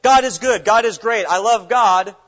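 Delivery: loud, high, hammed-up praise words; mock a showy believer's loud praise; empty churchy praise. Preacher mocks a talker without deeds, loud and high. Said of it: "if we're the kind of people that say".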